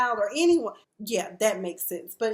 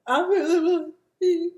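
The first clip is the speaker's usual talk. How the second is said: mock sobbing wail, higher and louder; hammed-up fake crying; 6 semitones higher, 6 dB louder than usual. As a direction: overact her crocodile tears. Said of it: "Ain't no tears coming out of her eyes".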